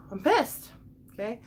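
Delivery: high-pitched, exasperated burst; angry outburst. Real, not acted. Woman venting on stream that she's mad, angry. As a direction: let anger push the pitch up sharply.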